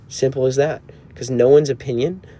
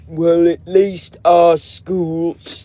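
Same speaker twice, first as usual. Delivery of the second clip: put-on very slow, stretched British accent; mock British; 4 semitones higher, much slower than usual. A British jab at America, in slow mock British. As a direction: drag out each word in hammed-up British. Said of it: "speak British now".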